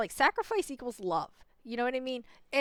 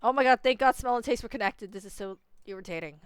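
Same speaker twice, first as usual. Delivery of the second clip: normal talking voice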